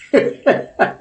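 short, high, happy laugh. Real, not acted. Host laughs because Brother Oscar is just happy, joyful laugh. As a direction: burst out laughing from sheer happiness. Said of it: "I'm laughing because Brother Oscar just happy".

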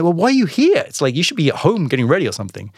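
high, loud retold disbelief, easing off; baffled, jumping up in pitch; incredulous challenge. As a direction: question them in astonishment. Astonished the groom is at work.

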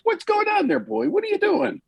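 very high-pitched dog-owner voice; talking to the dog. Acted. Owner asks his barking dog what's wrong, talking to the dog. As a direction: coo high at a worried pet.

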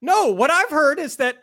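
high, put-on whiny contrarian voice; mock objection. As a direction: parody an indignant know-it-all. Playing devil's advocate in mock argument.

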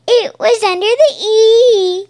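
high, loud sing-out cartoon voice; imitating cartoon pony. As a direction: belt it out high like an excited pony. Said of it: "she hops and she's like".

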